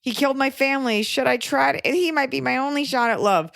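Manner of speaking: high-pitched mock dithering girl; lovelorn girl. Podcaster mocking girls who excuse bad exes, high mock-dithering voice. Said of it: "get so mad at girls".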